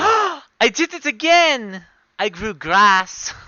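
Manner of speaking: high, loud, drawn-out cry; excited exclamation. Acted. Player announces their wand grew grass, excited.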